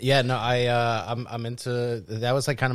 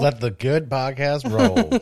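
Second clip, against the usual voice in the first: announcer voice; 4 semitones higher, 5 dB louder, slower than usual. Pretend. Co-host answers as slow announcer.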